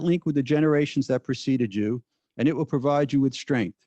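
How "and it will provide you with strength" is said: ordinary talk, nothing marked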